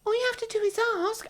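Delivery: high, rapid imitation; put-on squeaky quote; mocking husband. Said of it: "you would literally say".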